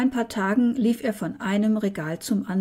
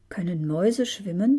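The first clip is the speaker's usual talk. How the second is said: ordinary delivery, nothing marked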